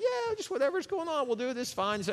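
high-pitched, airy mimicry of someone oblivious; mock carefree. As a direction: play someone breezily drifting through life. Carefree person wandering through life.

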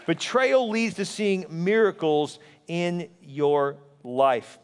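normal talking voice

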